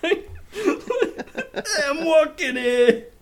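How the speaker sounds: put-on loud, high, drawn-out New York accent. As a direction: holler it as a cartoon New Yorker. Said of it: "That's like the stereotypical".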